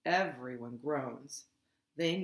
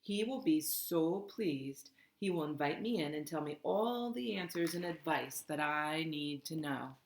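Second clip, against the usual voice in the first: ordinary delivery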